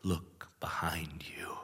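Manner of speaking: breathy, slow, hushed warning; spooky whisper. Acted. Narrator teasing listeners with a slow spooky whisper. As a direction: whisper it like a ghost story.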